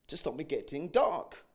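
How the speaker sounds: usual speaking voice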